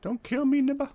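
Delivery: very high-pitched comic begging; play-panicked falsetto plea; mock pleading. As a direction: plead in a silly high voice, rising at the end. Begging enemy in game.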